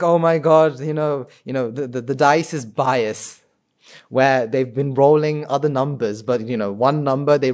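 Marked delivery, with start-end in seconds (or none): 0.0-3.4 sore-loser complaint. Retold or imitated. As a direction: accuse the dice like a bad loser. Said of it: "They'll be like".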